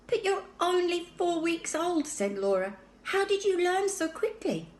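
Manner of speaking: put-on high, wondering girl's voice; astonished, asking gently; little girl Laura. A narrator's reading. Sister puzzled by talking baby, high and wondering.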